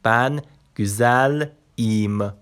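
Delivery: بن گوزل ایم said slowly, each syllable clear; slow Turkish example. Shown as a demonstration. Teacher to students, models a Turkish sentence slowly. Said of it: "نگاه کن".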